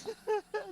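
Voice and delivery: squeaky giggling laugh; high laugh. Real, not acted. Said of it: "I don't mean to laugh".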